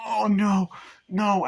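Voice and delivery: high, loud acted alarm; mock-panicked cry; panicked dismay. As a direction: play someone trapped and dreading it. Acts out woman getting caught.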